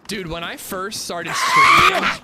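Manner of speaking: loud and fired up; excited shout. Real, not acted. Excited streaming story outburst.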